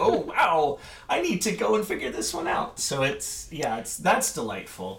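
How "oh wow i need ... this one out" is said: high, loud mock-startled self-talk; startled realisation. Teacher realising she must work it out, startled. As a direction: exclaim it as if suddenly caught out.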